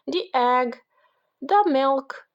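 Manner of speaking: ordinary delivery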